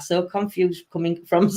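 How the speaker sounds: ordinary delivery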